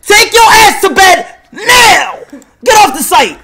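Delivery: scolding shout. Real, not acted. Scolding underage girl on camera.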